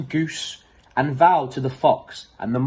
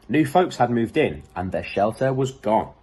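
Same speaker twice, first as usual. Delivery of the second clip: ordinary delivery, nothing marked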